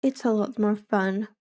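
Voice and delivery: usual speaking voice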